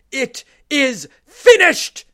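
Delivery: slow, huge, high triumphant shout; a dying shout of victory; loud cry. Acted. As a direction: cry it out with the last breath. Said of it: "in a loud voice, Jesus cries out".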